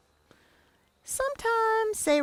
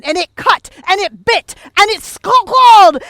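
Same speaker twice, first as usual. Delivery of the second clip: louder and higher with each line; rising climax; 7 semitones higher, 19 dB louder than usual. A narrator's reading. Teller hitting the attack climax, building to a loud high shout.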